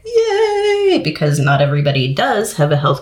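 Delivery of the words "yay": a squeaky, much higher little cheer; a quick, delighted yay; mock cheer. Parent hearing the kids get health classes, little cheer. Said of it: "which I was like".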